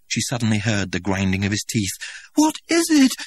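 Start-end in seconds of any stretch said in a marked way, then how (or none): 2.3-3.1 high, loud frightened near-scream; almost scream it in panic. Acted. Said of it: "she almost screamed".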